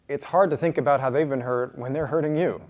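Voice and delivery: usual speaking voice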